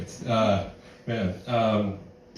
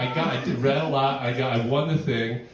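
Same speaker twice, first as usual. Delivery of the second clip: ordinary talk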